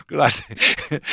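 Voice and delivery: usual speaking voice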